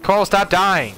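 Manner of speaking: loud high-pitched yell at teammate; exasperated yell. Real, not acted. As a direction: yell at him to stop dying. Yelling at teammate mid-fight.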